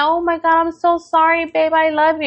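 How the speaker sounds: high-pitched gushing sweetheart, imitated; mock a lovey apology, pitched well up; smiling apology. Wife to husband on getting her bouquet, imitated smiling apology. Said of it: "she just starts smiling".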